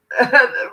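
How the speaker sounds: high, loud giggle. Real, not acted. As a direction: break into a high giggle mid-thought. Giggling along with the guides.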